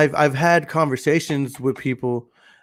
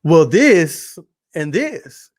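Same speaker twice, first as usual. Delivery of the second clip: slow, louder mock-stubborn retort; insistent comeback; 3 semitones higher, 6 dB louder, much slower than usual. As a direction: draw out each new objection, imitating an arguer. Retold arguer keeps pushing back.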